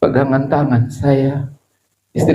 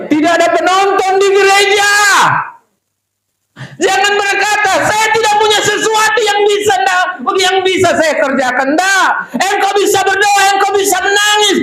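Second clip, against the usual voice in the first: fiery sermon shout; preaching at full force. Acted. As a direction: rouse the congregation from the pulpit.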